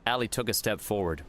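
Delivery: ordinary delivery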